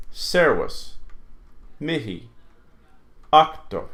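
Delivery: "Servus, Mihi, Octo" each hit at the start; first-syllable stress. Shown as a demonstration. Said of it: "always falls on the first syllable".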